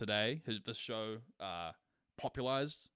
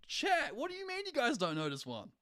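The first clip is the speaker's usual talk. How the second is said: incredulous; 13 semitones higher than usual. Real, not acted. Shocked chat doesn't know meme.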